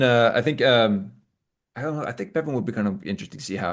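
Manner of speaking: ordinary talk, nothing marked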